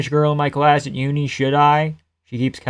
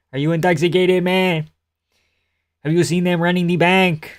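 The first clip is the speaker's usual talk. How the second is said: put-on Quebec French accent, higher pitched; GSP impression; 4 semitones higher than usual. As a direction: mimic a French-Canadian fighter's accent. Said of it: "Impression of anti-Semitic G S P".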